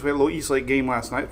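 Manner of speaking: ordinary talk, nothing marked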